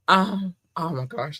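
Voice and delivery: usual speaking voice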